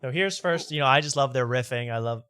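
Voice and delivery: ordinary talk, nothing marked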